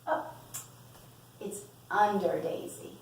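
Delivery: plain reading voice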